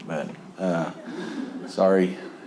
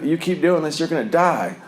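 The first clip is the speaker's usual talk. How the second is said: higher, mock-grave doom warning; mocking preachy warning; 8 semitones higher than usual. Mocking people at meetings, a mock doom warning. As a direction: parody the scare-tactic line from meetings. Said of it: "I hate when people say that at meetings".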